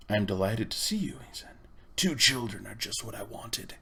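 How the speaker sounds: put-on menacing whispered old man; sinister whisper. A narrator's reading. Uncle Andrew gloats over the trapped children, sinister whisper. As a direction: whisper it like a creeping villain.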